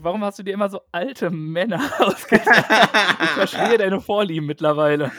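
giggling accusation, words bubbling with laughs; laughing. Real, not acted. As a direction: tease her while laughing. Teasing about old men, laughing.

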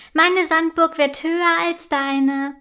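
höher und lauter, neckend überlegen; spöttisch. A narrator's reading. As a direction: die Schwester von oben herab aufziehen. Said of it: "sagt Sophie spöttisch". (Sophie neckt ihre Schwester, spöttisch und lauter)

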